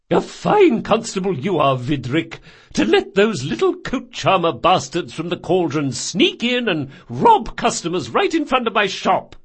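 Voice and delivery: high, loud scolding; furious old woman. Acted. Old shopkeeper to watch sergeant, high angry scolding. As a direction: tear into the constable.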